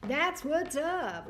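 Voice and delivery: slow, pleased sing-song; drawn out. Real, not acted. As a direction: savor each word with delight. Drawn-out repeat of the phrase.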